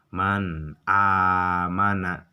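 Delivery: man amana, slowly, the nun clearly pronounced; drawn out man amana, each letter distinct; clear recitation. Shown as a demonstration. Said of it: "Kebacanya harus jelas".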